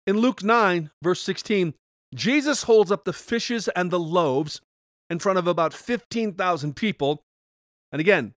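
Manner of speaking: ordinary delivery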